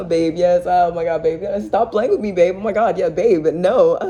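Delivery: mock lovesick squeals, loud and high; mocking the girlfriend.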